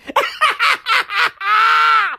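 high burst of laughter; cracking up; laughing. Real, not acted. Laughing at own joke.

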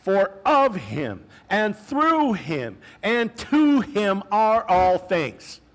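each phrase slow and drawn out, rising in awe; slow emphatic reading. Shown as a demonstration. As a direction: lay out every phrase slowly as a proclamation. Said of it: "Listen to this".

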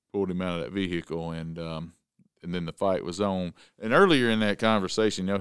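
ordinary delivery

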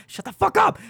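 high mock scream at the crowd; retold scream. Woman losing her mind screams at campus crowd, mock shriek. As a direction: shriek it like she's snapped. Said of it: "she's just losing her mind saying".

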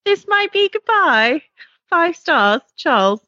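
reading a listener review in a put-on British accent. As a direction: read it out in a mock posh British accent. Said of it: "to do this review in a British accent".

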